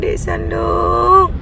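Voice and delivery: a tired mother dragging out her son's name; exasperated drawn-out call. Real, not acted. Mami calling her slow son, long and exasperated. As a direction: stretch the name out slowly, fed up. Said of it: "menguji tingkat kesabaran Mami".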